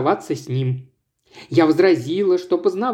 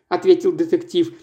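ordinary delivery

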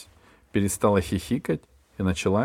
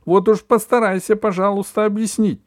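much higher, loud shout; 12 semitones higher, 7 dB louder than usual. A narrator's reading. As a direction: scream it in fury. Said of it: "кричала мама".

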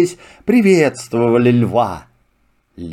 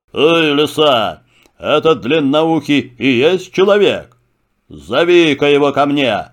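commanding lion. Acted. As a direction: order it like a king of beasts. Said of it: "приказал лев". (властный голос льва)

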